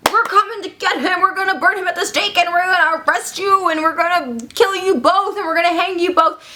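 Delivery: high, chanting mob threat; angry townspeople. Acted. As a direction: threaten like a hammed-up village mob. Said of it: "the townspeople are like".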